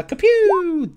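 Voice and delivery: drawn-out, high "kapew"; playful long falling zap noise; mock shot sound. Imitated shot as dice are rolled.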